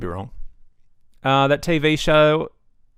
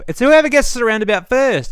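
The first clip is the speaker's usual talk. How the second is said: WHOEVER punched loud and high; emphatic rant; 10 semitones higher, 7 dB louder than usual. Real, not acted. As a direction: hit the word whoever hard and loud. Heated rant about roundabout rules.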